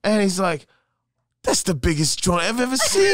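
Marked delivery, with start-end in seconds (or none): 1.4-3.1 high, loud mock-British amazement; British accent. British guy amazed at the American's joint, imitated British accent. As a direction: exclaim it as an imitated astonished Brit. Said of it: "you got a pretty good accent there".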